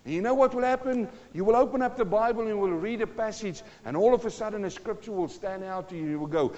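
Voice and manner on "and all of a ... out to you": ordinary delivery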